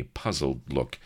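ordinary delivery

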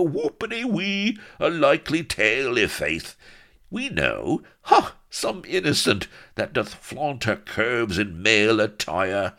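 high, loud jeering pirates; mock with sing-song glee; loud taunting in a high mocking voice; derisive hooting. A narrator's reading. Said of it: "hooted with derision and made vulgar noises".

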